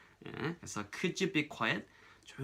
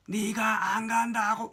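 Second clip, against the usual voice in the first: high, loud mock yell; 10 semitones higher, 5 dB louder than usual. Child talking back to parents, loud yelling. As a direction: belt it out like someone in a family row. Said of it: "이런 식으로 크게".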